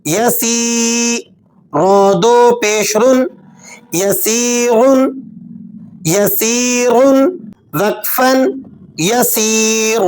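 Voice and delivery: long stretched ی in یسیرن; drawn-out vowels. Shown as a demonstration. Teacher drawing out the long vowel for the class. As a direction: stretch the vowel for two beats. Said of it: "اسے کھینچ کر پڑھیں گے".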